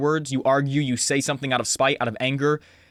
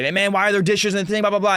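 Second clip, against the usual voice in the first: mildly irritated mock dad, pitched up; annoyed dad; 6 semitones higher than usual. Dad coming in to complain about dishes, annoyed. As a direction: imitate a dad nagging about chores. Dad retold getting upset.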